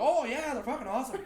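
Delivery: high-pitched mock Irish-Canadian agreement; Irish Canadian accent. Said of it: "Irish Canadian".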